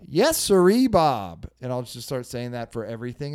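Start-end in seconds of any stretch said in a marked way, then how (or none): 0.1-1.5 put-on old-timey phrase. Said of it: "I'll say, like".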